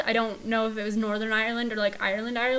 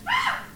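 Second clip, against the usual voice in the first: short shriek, far higher; sudden high yelp. Real, not acted. Co-host in banter about Irish accents, high yelp. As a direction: let out a quick high-pitched squeal.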